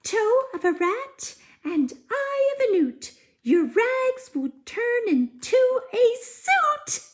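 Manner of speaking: very high chanted rhyme; fairy spell rhyme. Acted. Fairy casting a rhyme to turn rags into a suit, high chant. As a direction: chant it like a tiny witch.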